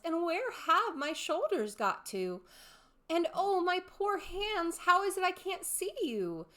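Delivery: put-on a little girl losing sight of her hands; Alice, alarmed. A narrator's reading. Alice voiced by narrator.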